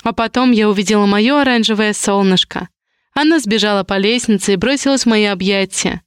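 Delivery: ordinary delivery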